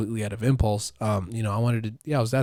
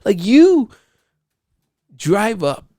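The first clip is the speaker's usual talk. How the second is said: much higher, loud ranting whine; escalating rich-guy tantrum over lattes; mock tantrum; 11 semitones higher, 6 dB louder than usual. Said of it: "he's like".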